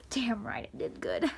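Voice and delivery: smug whispered retort; whispered aside. Retold or imitated. Girl retelling her reply to praise, whispered. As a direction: whisper it like a private boast.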